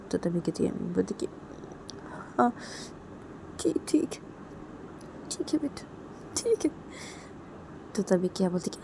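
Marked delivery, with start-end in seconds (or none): 2.4-6.8 high, very soft, breaking into near-whisper; tearful acceptance. Acted. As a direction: give in weakly, drained from crying. Mother accepts through tears. (रुआँसी, दबी आवाज़ में मान जाना)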